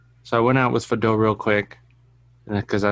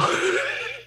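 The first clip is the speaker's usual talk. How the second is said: real high-pitched squealing giggle; unvoiced high giggle; high squeaky laugh; 5 dB quieter than usual. Real, not acted. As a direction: let a breathless squeak of a laugh out. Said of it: "And I laughed so".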